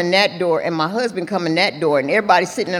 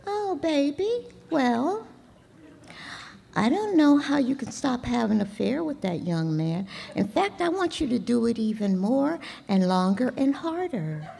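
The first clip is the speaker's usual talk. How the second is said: put-on much higher and much softer, sugary old mentor; Betty's sweet voice; 4 semitones higher, 8 dB quieter than usual. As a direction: coo it like a doting sponsor. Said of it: "she would say beautiful, delicate things like this".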